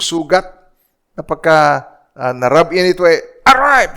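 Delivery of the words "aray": sudden high yelp of hurt; mock cry of pain. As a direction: yelp as if a wound was touched. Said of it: "Parang ganon". (biglang sigaw ng sakit)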